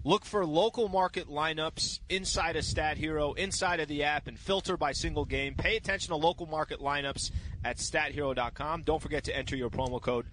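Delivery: slow, deliberate sponsor read; measured radio sponsor plug. Acted. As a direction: read the ad copy like a host. Reads sponsor ad.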